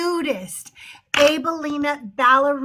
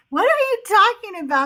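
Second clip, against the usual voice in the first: high, indignant mock disbelief; baffled comeback to an absurd accusation; incredulous retort; 7 semitones higher, 3 dB louder than usual. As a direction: throw it back at them, pitched way up. Acted retort to commenters' accusation.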